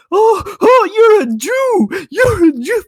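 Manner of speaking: ape the troll's jeering voice; high mocking whine of an online troll; mocking taunt.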